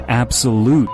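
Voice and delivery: ordinary delivery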